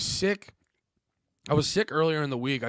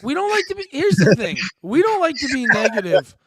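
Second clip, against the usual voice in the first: high, loud insistence; comic, over-loud declaration; emphatic disclaimer; 9 semitones higher, 10 dB louder than usual. Real, not acted. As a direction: loudly protest over your co-host's laughter. Loudly echoing the negativity disclaimer.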